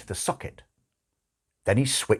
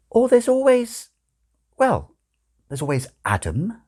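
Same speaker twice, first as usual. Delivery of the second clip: lightly voiced nun; 13 semitones higher than usual. Nun offers safe name.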